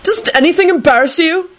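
high, indignant question; angry blurt. A narrator's reading. Neville scolds Rosie at the table, high indignant anger. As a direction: accuse with pitch shot up. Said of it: "he blurted out angrily".

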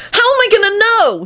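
put-on very high, desperate child; wailing. A narrator's reading. Timothy desperate to know his father, wailing. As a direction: wail it high without much volume. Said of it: "he wailed".

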